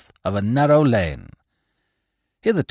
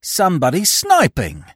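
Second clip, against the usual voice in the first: cried out; 7 semitones higher, 4 dB louder than usual. A narrator's reading. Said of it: "cried the man".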